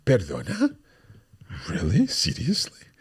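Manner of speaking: rising, incredulous "Perdona?"; disbelieving question, pitch climbing at the end; questioning surprise. Shown as a demonstration. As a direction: ask it as if you can't believe it. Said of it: "we'll put a question mark in there".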